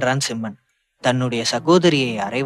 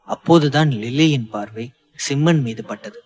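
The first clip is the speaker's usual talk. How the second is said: plain reading voice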